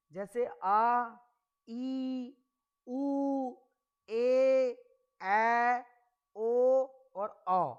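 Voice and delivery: each vowel आ ई उ ए ऐ ओ औ held long and loud; long vowels drawn out. Shown as a demonstration. Teacher drawing out the long vowels for students, slow and loud. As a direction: stretch every long vowel clearly. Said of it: "वो दीर्घ स्वर की श्रेणी में".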